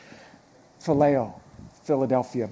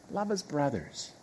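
"Love as brothers" repeated much softer, turned over in thought; soft musing repeat; 11 dB quieter, slower than usual. Shown as a demonstration. Preacher echoing the verse to himself, quiet and reflective. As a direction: let it sink in, softly.

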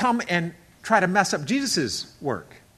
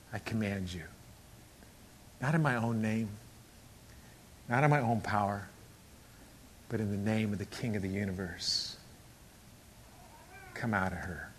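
low, very soft, measured authority; quiet command; 11 semitones lower, 16 dB quieter than usual. Acted. Apostle commanding the spirit to come out, quietly. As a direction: command softly, pausing between phrases.

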